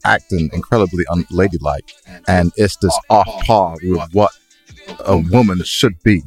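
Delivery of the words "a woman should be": usual speaking voice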